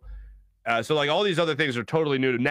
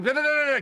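nagging da da; 11 semitones higher, faster than usual. Retold or imitated. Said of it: "I'm just like".

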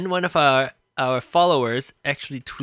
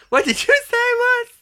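ordinary delivery

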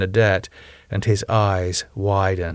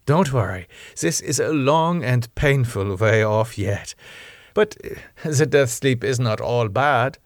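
put-on lilting German-accented vampire lady, slightly raised; German-accented Rose, patient; 3 semitones higher than usual. Rose explaining the death sleep to Damo, patient German accent.

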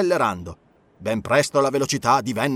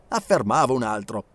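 ordinary delivery

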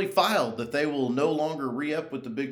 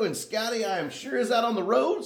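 ordinary talk

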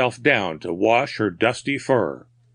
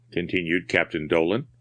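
ordinary delivery

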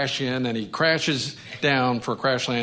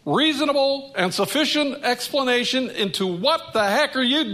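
demanding terms from God; 8 semitones higher than usual. Pretend. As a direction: lay out your terms to God like a haggling tenant. Pastor acts out demands to God.

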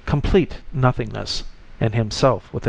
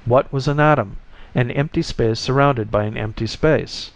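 usual speaking voice

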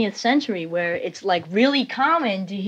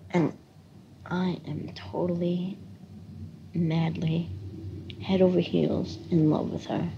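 hushed confession; 8 dB quieter than usual. Real, not acted. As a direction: confide it quietly, as if a secret. Confessing love softly.

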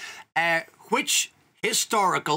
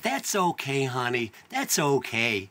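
soothing reassurance; 6 semitones lower, slower than usual. Retold or imitated. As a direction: gently settle an upset caller. Kind clerk soothing him on phone.

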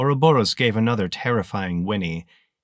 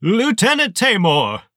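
high, loud, sneering villain voice; towering armored colonel greeting a lieutenant, high and loud; menacing colonel; 12 semitones higher, 7 dB louder than usual. Acted. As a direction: let it cut like a rusty saw blade. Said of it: "like a rusty saw blade slicing through your spine".

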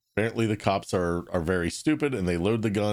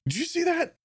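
high-pitched mock disbelief; incredulous friend. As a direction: ask it high, as if scandalized. Friend acting out suspicious surprise.

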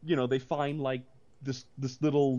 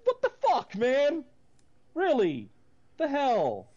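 incredulous outburst; 6 semitones higher than usual. Retold or imitated. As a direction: blurt it at the screen in shock. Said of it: "I'm like".

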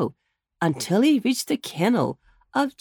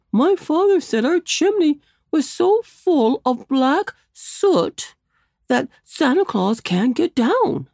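put-on higher, louder boy's voice; second little boy; 7 semitones higher, 4 dB louder, slower than usual. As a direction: complain to his friend earnestly.

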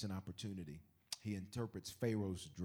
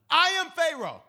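higher, louder royal proclamation; grand Pharaoh; 16 dB louder than usual. Retold or imitated. Pharaoh declaring himself to Joseph, loud and high. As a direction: announce yourself as king. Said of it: "he says it like this".

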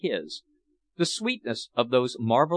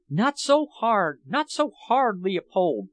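higher, slow, pleading plea; imploring; 6 semitones higher than usual. A narrator's reading. Princess imploring Leopold to ease his harshness, high slow plea. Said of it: "she implored".

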